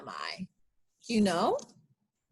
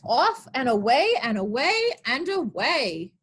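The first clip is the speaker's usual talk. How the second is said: ordinary delivery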